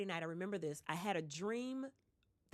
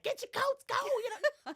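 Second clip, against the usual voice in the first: much higher, louder rushed call; hurried call; 5 dB louder than usual. Retold or imitated. Teacher sending the kids out, high and rushed. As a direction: shoo the kids out in an excited rush.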